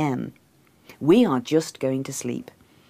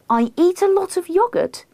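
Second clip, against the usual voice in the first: very high, rising disbelief; incredulous echo; 3 dB louder than usual. A narrator's reading. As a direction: throw it back as an outraged question. Echoes him, incredulous.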